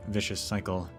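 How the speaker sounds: ordinary delivery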